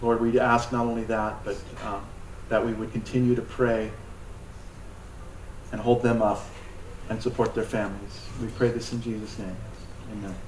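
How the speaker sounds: soft, hushed prayer to the Lord. Acted. Pastor praying softly for prisoners, hushed prayer voice. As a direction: pray quietly over the persecuted.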